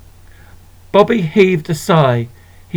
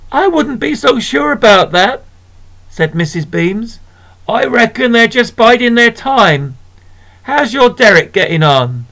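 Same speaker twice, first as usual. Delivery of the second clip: knowing shopkeeper; 7 semitones higher than usual. Acted. Missus Beams voiced.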